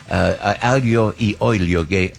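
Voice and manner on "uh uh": ordinary talk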